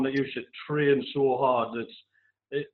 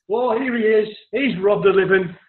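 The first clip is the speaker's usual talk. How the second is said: loud, higher mock shout across the pub; retold shout; 6 semitones higher, 5 dB louder than usual. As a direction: boom it out like the life of the party. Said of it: "Top of his voice".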